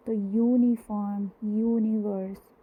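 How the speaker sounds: यूनिफॉर्म and यूनिवर्स drawn out, each starting with a clear Y sound; slow sounded out. Shown as a demonstration. Teacher to students, slowly modelling the Y sound. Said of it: "वाई consonant की".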